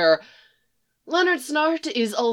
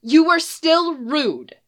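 higher, slow and louder reproach; insist, offended; exclaiming enchantress; 7 semitones higher, 5 dB louder, slower than usual. Acted. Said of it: "she exclaims".

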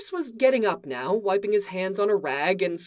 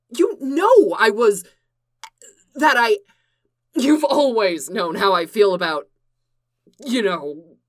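flustered outburst; 2 semitones higher, 7 dB louder than usual. Acted. Clark floundering, upset.

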